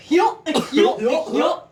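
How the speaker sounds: loud mock yup into a tunnel; lightly voiced bloke chanting into a tunnel; imitating a dude. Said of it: "the one dude going".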